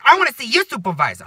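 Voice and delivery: high, loud mock New York demand; hammed-up New York complainer; New Yorker accent. Woman demanding the next boss, mock New York accent. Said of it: "like, New Yorker accent".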